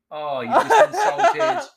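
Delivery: slow, loud laughing self-scolding; laughing at his own gaffe. Real, not acted. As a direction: scold yourself through laughter.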